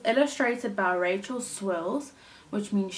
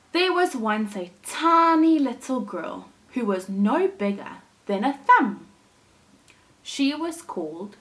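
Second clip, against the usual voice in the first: put-on lively storytelling for children; animated read-aloud for little listeners; story narration; 4 dB louder than usual. A narrator's reading. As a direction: read it like a picture book to a class. Teacher reads story aloud.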